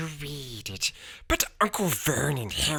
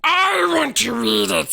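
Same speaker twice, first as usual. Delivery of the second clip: high, loud angry insistence; furious; 12 semitones higher, 7 dB louder than usual. A narrator's reading. Boy furiously claiming his own letter. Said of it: "said Harry furiously".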